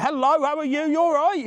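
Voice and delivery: high-pitched, bright hello to passers-by; real high, sing-out hello; cheery greeting. Real, not acted. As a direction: call out a friendly greeting, pitched right up.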